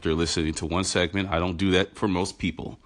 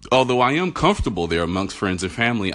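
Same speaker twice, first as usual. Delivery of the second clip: normal talking voice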